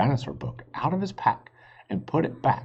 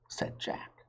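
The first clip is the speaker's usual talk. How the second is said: usual speaking voice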